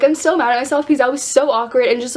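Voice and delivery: ordinary delivery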